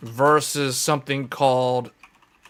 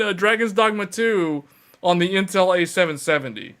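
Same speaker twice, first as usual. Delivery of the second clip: usual speaking voice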